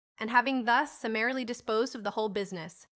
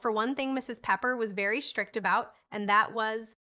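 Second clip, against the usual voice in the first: plain reading voice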